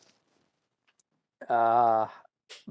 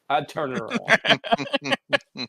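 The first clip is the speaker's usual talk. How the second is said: slow, higher, put-on resigned voice; resigned deadpan; 10 semitones higher, much slower than usual. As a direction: drawl it like you've given up. Spock gives up on the hijinks.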